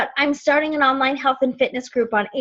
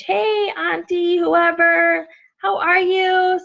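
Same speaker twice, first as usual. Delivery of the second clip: higher, chirpy hello to a relative; sing-song friendly message voice; friendly greeting; 6 semitones higher, slower than usual. Retold or imitated. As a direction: greet an aunt in a friendly, raised voice.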